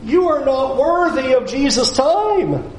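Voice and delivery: high, scolding crowd voice; mock crowd scolding a blind beggar, pitched high; scornful crowd. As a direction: shoo the beggar away with contempt. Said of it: "they're looking at him going".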